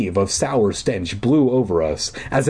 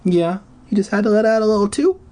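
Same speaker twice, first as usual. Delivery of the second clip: high, fast cooing pet talk; 11 semitones higher, faster than usual. Real, not acted. Reader cooing at pet Franklin in baby talk. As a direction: coo at the cat.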